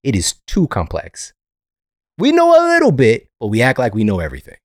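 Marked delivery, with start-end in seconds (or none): 2.2-3.3 much higher and louder; high mocking pitch. Real, not acted. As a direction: pitch it way up in mockery. Mocking exaggerated claim.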